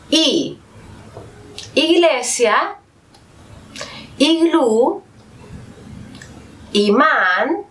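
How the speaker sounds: each vowel then its words, the vowel always pure, like Espejo, Iglú, Ojo, Uno; teacher reading examples. Shown as a demonstration. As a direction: model each word cleanly with a pause after. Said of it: "Veamos algunos ejemplos". (ejemplos de vocales, cada palabra clara y pausada)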